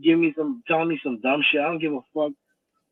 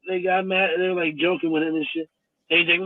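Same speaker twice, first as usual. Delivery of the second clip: ordinary talk, nothing marked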